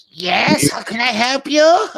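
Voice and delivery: put-on high, drawn-out old granny voice; put-on granny voice, slow and high. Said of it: "walking up to some old granny".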